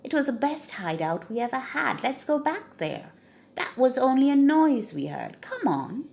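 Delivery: high, fast coaxing; talking the gang into going back; eager robber. Acted. Robber urges return.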